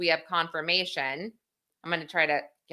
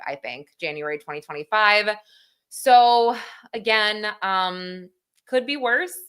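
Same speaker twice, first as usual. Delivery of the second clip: usual speaking voice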